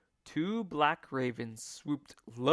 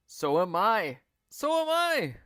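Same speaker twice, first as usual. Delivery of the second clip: put-on louder, higher frightened old man; cried out; 7 semitones higher, 7 dB louder than usual. A narrator's reading. Mister Twit crying out in fright to his wife. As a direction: cry it out. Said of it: "cried Mister Twit".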